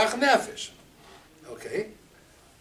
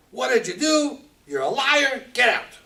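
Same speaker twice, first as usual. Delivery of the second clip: loud, high accusation; angry Pharaoh; 7 semitones higher, 7 dB louder than usual. Acted. Pharaoh calls Avram a liar, loud and angry. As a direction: spit out the accusation.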